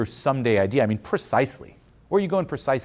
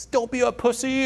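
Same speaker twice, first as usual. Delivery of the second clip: mock taunt; 12 semitones higher than usual. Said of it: "you might be like".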